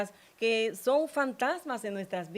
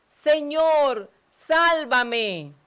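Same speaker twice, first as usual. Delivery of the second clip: higher, louder cry for rescue; desperate cry; 6 semitones higher, 8 dB louder, much slower than usual. A narrator's reading. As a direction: shout as if sinking. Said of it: "empezó a hundirse y gritó".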